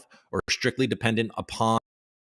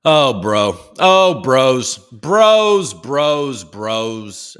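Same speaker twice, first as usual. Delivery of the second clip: loud, drawn-out call to the bros, fading at the end; hollering for the bros, trailing away; 3 semitones higher, 11 dB louder, much slower than usual. Real, not acted. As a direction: hail the bros in the chat, then let it die off. Calling out to the bros.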